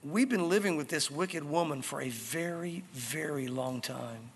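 long-suffering. Acted. Eunuchs thinking about their wicked queen, long-suffering. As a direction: drag it out, fading on "very long time".

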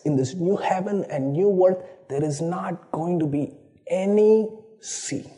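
ordinary delivery

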